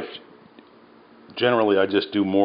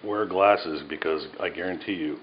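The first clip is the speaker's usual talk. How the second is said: usual speaking voice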